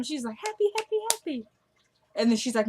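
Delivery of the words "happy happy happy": high-pitched imitation of gleeful repetition; happy singsong, much higher; mock happy chirp. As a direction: chant it high, putting on her cheer. Imitating her celebrating a happy time.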